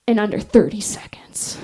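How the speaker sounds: whispered deadline. Retold or imitated. Instructor whispering the deadline to the recruits. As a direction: hush the time limit like a secret. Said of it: "And she goes".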